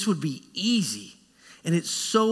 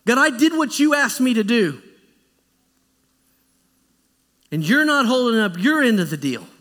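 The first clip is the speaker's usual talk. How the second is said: louder, higher accusation; indignant Moses; 6 semitones higher, 5 dB louder than usual. Acted. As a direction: hammer the complaint home. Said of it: "Moses is pointing back to God".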